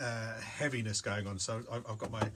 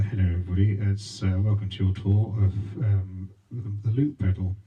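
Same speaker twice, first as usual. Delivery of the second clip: loud, lower mic host voice; into the microphone; 5 semitones lower, 13 dB louder than usual. Shown as a demonstration. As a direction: welcome them like a tour guide on the mic. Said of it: "I've got a microphone here".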